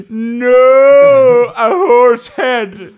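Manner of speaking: high, loud, long-drawn mock cry; hammed-up anguished wail. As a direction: wail it out melodramatically. Film character finds horse head.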